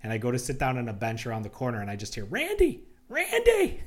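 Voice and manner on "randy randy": very high, loud imitated summons; calling out. Imitating partner calling him back at airport security, calling out. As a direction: holler the name in falsetto, louder the second time.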